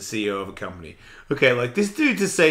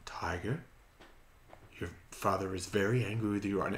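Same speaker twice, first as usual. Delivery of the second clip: whispered, hushed telling-off; mock a soft, polite scolding; soft gentle parenting; 4 semitones lower, 11 dB quieter than usual. Dad showing the soft way of scolding Tiger, whispered. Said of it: "if I say".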